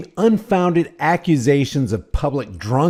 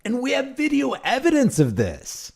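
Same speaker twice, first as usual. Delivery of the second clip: mock-earnest, pitched up high; sarcastic; 8 semitones higher, 3 dB quieter than usual. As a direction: fake sincerity before the joke lands. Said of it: "I'm just kidding".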